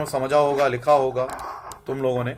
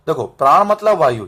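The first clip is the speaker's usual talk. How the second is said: normal talking voice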